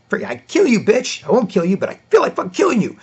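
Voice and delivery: high, hammed-up angry yell; comic over-the-top rage; mock furious rant. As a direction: sputter it out like you're losing it. Furious boyfriend voiced mid-fight.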